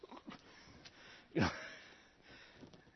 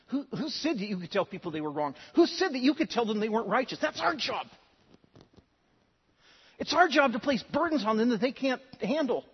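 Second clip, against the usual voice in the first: mocking indignant Pharisees; 6 semitones higher, 4 dB louder than usual. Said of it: "And they said".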